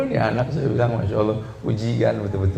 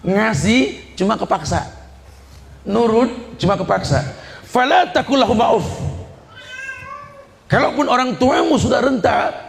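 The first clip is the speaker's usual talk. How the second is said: raised, high impassioned sermon voice; fervent preaching; 8 semitones higher than usual. Acted. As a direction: preach it hard to the crowd. Preaching at full force.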